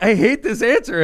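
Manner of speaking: mock exasperated whine; frustrated. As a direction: moan it in disgust. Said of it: "I'm like".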